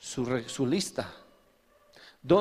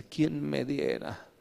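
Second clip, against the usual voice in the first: mournful Samson; 4 semitones lower, 5 dB quieter than usual. Retold or imitated. Preacher voicing weeping Samson, low and mournful. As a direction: plead softly and sadly for one more chance. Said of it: "Y decía".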